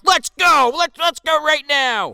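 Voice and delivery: put-on high, loud drunken yelling; drunk challenge. Drunk Achilles challenging Alexander, high loud mock yell. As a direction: spoil for a fight like a belligerent drunk. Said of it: "He, like, drunkenly".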